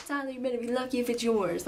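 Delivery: dad's joking warning in a half-whispered voice; goofy dad teasing. Retold or imitated. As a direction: tease like a goofy father, voice mostly breath. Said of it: "just being so stupid".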